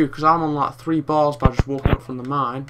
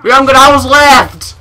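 furious shout; 8 semitones higher, 12 dB louder than usual. Real, not acted. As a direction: bellow in disbelief. House blown up.

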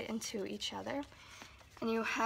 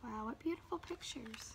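whisper; 10 dB quieter than usual. Acted. Whispered wonder.

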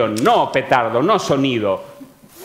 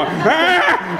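startled yelp; 10 semitones higher, 3 dB louder than usual. Retold or imitated. Old people startled by cold fire.

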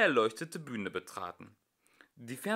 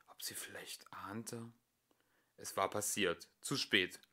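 whispering; 4 semitones lower, 9 dB quieter than usual. A narrator's reading. Anxious inner wondering.

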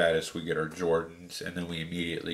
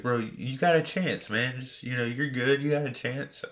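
imitating store worker; 7 semitones higher than usual. Store worker reassuring him.

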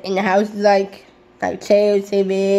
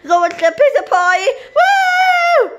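excited shout; 14 semitones higher than usual. Real, not acted. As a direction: whoop it up. Excited calling out to pizza party.

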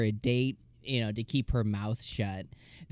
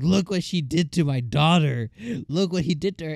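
mock outraged dad; 4 semitones higher, 6 dB louder than usual. Retelling the duke's angry complaint.

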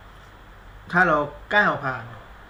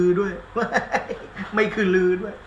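laughing; 5 semitones higher than usual. Real, not acted. Joking wordplay, laughing.

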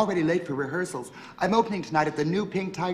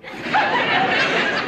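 high, loud, breathy burst of laughter; a big amused trill of a laugh; 5 dB louder than usual. Acted. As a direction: laugh out loud at the cheeky hint. Beverly laughs at Archie's hint.

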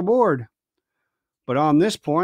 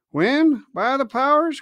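crying out; 8 semitones higher, slower than usual. Acted. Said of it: "cried Silver".

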